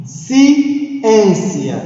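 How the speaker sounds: "Ciência" split after CI, ÊN high and stretched; stressed syllable. Shown as a demonstration. Teacher pointing out the tonic syllable in "Ciência", ÊN stressed. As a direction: break up "Ciência" and push the ÊN. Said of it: "pronunciei com mais força".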